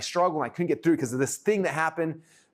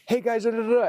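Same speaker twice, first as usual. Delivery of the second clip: much higher, chirpy camera greeting; nervous live intro; 9 semitones higher, 3 dB louder than usual. Retold or imitated. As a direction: play someone opening a Facebook Live a bit nervously. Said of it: "be like".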